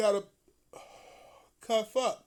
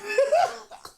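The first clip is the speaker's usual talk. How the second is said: high, loud burst of laughter; shrieking laugh; 10 dB louder than usual. Real, not acted. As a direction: crack up hard. Big laugh.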